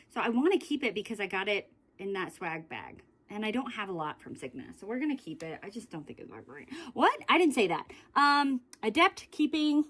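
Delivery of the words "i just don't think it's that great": muttered aside. Real, not acted. Muttered aside about the gloss.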